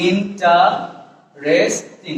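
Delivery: slow ইন্টারেস্টিং split as in - ta - res - ting; four syllables. Shown as a demonstration. Teacher showing how we say it, ইন্টারেস্টিং slowly as in-ta-res-ting. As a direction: separate each syllable of ইন্টারেস্টিং. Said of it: "আমরা বলি".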